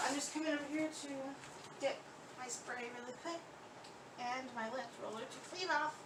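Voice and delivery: muffled and far from the mic; talking from inside a cabinet, muffled. Real, not acted. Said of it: "if I sound a bit muffled".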